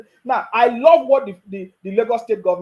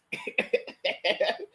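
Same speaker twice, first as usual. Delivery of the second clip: soft mocking laugh; 2 semitones higher, 7 dB quieter than usual. Real, not acted. Mocks the crying supporter.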